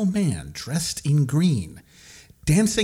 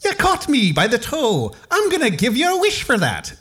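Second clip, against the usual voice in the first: leprechaun voice; 6 semitones higher than usual. Acted. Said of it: "said the leprechaun".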